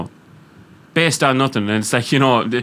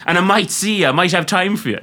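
mock haughty Irish woman; 7 semitones higher than usual.

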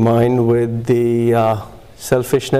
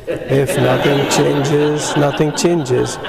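retold slow read-out, words drawn out; slow, deliberate reading; 3 semitones higher, much slower than usual. Newcomer rereads the chip for the chip person, slowly. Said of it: "Read it slowly".

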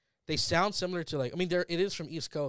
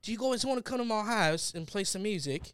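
higher, softer English accent; fake-sounding English accent, pitched up; 5 semitones higher, 5 dB quieter than usual. Shown as a demonstration. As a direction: put on a careful English accent. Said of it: "you'll do a perfect accent".

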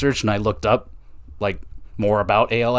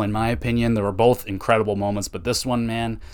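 ordinary delivery

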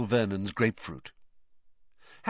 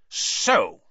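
angry; 6 dB louder than usual. Acted. Furious uncle confronts Harry.

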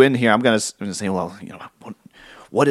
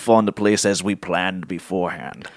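evil voice; 5 semitones lower than usual. Acted. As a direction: lay out the plan like a villain. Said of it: "my evil voice".

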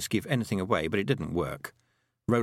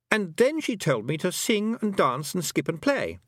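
put-on high boy's voice, put out; boy character, put out; 9 semitones higher than usual. A narrator's reading. Roland telling what the Queen made him do, high boy. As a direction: grumble in a high boyish pitch.

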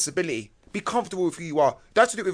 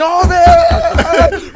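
higher, louder laughing shout; cheering crowd; 12 semitones higher, 13 dB louder than usual. Retold or imitated. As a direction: yell like the whole wing hyped. Whole wing cheering the song.

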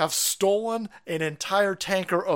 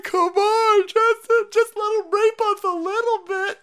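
high, hammed-up sobbing plea; exaggerated crying, pitched high; mock tearful begging; 8 semitones higher, 6 dB louder than usual. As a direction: wail it pleadingly as a mocking imitation. Mocks the begging woman in tears.

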